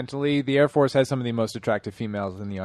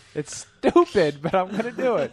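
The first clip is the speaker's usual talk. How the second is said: laughing through the words; giggling while quoting; 5 semitones higher than usual. Real, not acted. Repeating movie line while laughing.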